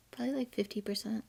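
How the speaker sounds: breathy whisper, quick and hushed; whispered guess. Real, not acted. Cohost whispers her guess to the host, hushed. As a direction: slip the number out under your breath. Quiet guess about fake shows.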